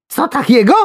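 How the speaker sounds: very high, loud squeak; panicked squeal. A narrator's reading. Worm hearing of casting, panicked squeal. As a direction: yelp in absolute panic. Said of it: "kwiknął w absolutnej panice".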